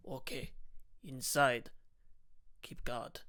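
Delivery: hushed, very soft orders; whisper. A narrator's reading. Fat Death ordering the others inside, whispering. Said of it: "and whispers".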